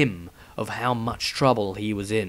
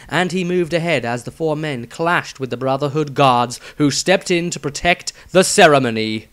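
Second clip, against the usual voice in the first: ordinary delivery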